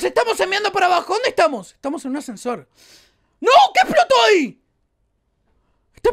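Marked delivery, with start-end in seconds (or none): 3.4-6.1 startled cry. Real, not acted. Explosión en la mina.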